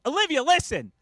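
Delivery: loud, high call bursting through the door. Retold or imitated. Dad bursting into the bathroom to lecture his daughter, shouting. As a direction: barge in and shout her name.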